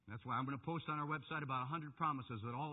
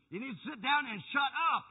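loud, high preacher's order; forceful command; 12 semitones higher, 11 dB louder than usual. Real, not acted. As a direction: order the congregation at raised voice. Forceful repeat of quiet command.

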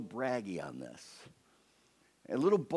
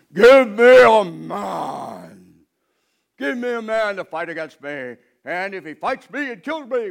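loud, slow, higher bellowing dare; booming giant challenge; 7 semitones higher, 14 dB louder, slower than usual. Acted. Goliath daring the armies of Israel, loud booming challenge. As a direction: roar it across the battlefield. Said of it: "and says".

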